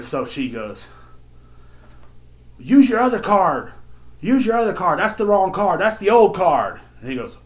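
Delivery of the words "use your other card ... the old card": high, loud mock nagging; bossy yelling daughter. Daughter corrects her deaf dad's card choice, yelling. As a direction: imitate yelling instructions at a deaf father.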